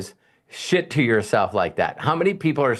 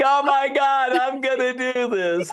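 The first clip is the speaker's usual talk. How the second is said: mock excitement; 12 semitones higher than usual. Host mocks her first-trade loss.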